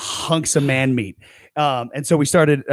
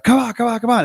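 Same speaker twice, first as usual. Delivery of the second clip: eager urging; 10 semitones higher, faster than usual. Retold or imitated. Dad urging, then giggling.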